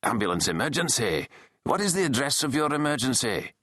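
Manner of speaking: Scottish emergency operator; broad Scottish accent. A narrator's reading. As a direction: take the call in broad Scots. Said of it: "said in a broad Scottish accent".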